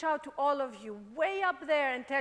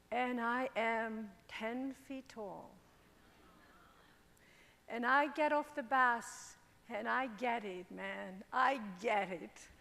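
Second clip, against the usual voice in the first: quiet awe; 6 semitones lower, 6 dB quieter than usual. Real, not acted. Quiet realization after bus.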